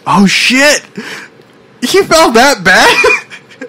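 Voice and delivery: high, slow, drawn-out astonishment; shocked disbelief. Real, not acted. Reacting to a man needing oxygen, high slow shock. Mock shock at news story.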